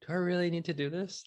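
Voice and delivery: high, fast, fed-up self-question; lightly hammed-up weariness; mock exasperated. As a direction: toss it off like a tired complaint. Self-quote of weary doubt.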